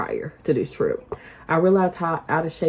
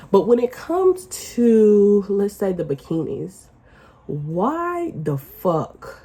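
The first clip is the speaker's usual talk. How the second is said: usual speaking voice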